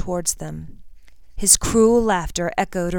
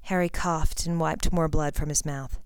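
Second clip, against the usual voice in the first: usual speaking voice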